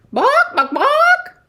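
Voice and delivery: very high, loud yapping with a laugh; yappy bark. Acted. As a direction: yap like a tiny dog, giggling. Dog barks again.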